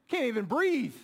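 ordinary talk

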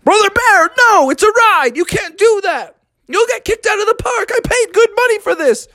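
high, urgent alarmed scolding; frantic protest. Acted. Father Frog stopping Brother Bear on the ride, frantic high scolding. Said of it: "Father Frog was like".

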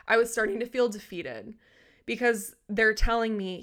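usual speaking voice

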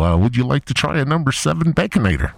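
high, loud, upselling drive-thru voice on "number seven Baconator"; Harold from Wendy's. Acted. Wendy's worker Harold upselling the caller, high and loud. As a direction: pitch the Baconator like a chipper cashier. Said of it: "this is Harold from Wendy's".

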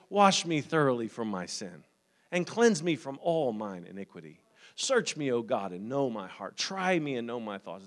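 low, hushed prayer. Shown as a demonstration. Preacher showing how to pray through the text, hushed prayer. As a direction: pray it softly and low, with pauses. Prays a psalm as example.

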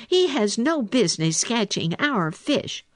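high-pitched, indignant protest; fierce little otter staking a claim. A narrator's reading. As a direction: object fiercely, pitched well up. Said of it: "said Little Joe fiercely".

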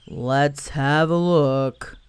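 very slow gloomy donkey voice; a weary donkey turning to look. Acted. Eeyore checks his tail.

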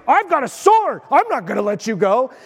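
lively retold defiance; defiant protest. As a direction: act out an eager disciple's protest. Acting out Peter's defiant protest.

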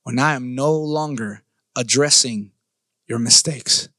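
low, measured warning with pauses; imitated coach's warning. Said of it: "He says".

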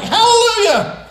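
high, loud, slow hallelujah; shouted hallelujah. Shown as a demonstration. Preacher showing the church how to praise with a loud shout. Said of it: "with a loud tone or shout like".

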